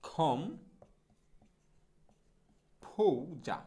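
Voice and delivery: খম, long pause, then ফৌজা; syllable-by-syllable demo. Shown as a demonstration. Teacher breaking down composer, খম then ফৌজা separately. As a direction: break it into "khom ... phou-ja". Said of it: "সেটা হচ্ছে যে".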